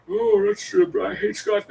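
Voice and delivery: high, exaggerated joke voice; mock put-on voice. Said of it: "it's not like I'm out here like".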